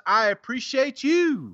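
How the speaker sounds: showy sign-off to a reviewer; catchphrase flourish. Real, not acted. Same catchphrase to next reviewer.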